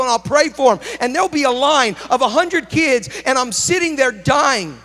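fervent pulpit delivery; preaching at full force. Acted. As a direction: drive it home to the congregation. Preaching to congregation.

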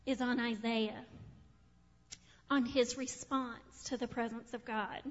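quiet and intent, pulling them in; sudden soft focus. Real, not acted. Preacher drops to intimate tone.

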